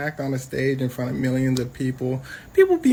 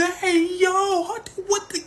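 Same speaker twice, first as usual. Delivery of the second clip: much higher squeaky outrage; mock Jerry; 13 semitones higher than usual. Jerry reacting to the slur, high squeaky shock. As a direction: yelp it like a shocked cartoon. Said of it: "Jerry goes".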